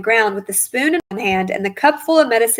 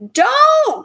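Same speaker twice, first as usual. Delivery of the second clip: much higher, louder panicked "Don't!"; frantic cry; 6 dB louder than usual. A narrator's reading. Mother panicking as grandma grabs the cup, frantic cry. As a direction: shriek to stop her. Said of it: "cried Missus Cranky".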